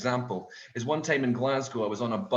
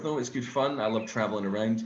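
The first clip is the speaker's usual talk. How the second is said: usual speaking voice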